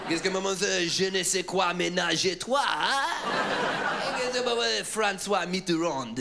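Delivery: high, drawn-out fake French; French-sounding babble with random real words dropped in; exaggerated French impersonation; mock French noises. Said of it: "I make French noises and then drop in".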